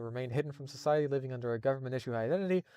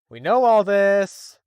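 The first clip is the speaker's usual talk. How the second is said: louder, higher, slow exasperated shout; exasperated yell; 6 semitones higher, 13 dB louder, much slower than usual. Real, not acted. Fed up with the story's recap, shouting slowly. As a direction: bellow it at the recap.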